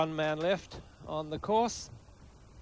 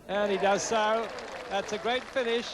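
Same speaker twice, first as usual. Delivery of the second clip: rising, louder burst of excitement; excited; 7 semitones higher, 4 dB louder than usual. Real, not acted. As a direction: call the putt dropping with delight. Final birdie putt drops.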